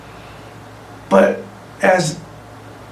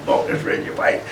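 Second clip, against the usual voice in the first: hushed inner thought; 7 dB quieter than usual. Retold or imitated. Spotting teammate mid-play, inner thought.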